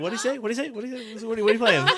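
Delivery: high, rapid-fire mimicked questions; mock eager curiosity. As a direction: pester like someone suddenly all ears. Mimics listeners suddenly paying attention.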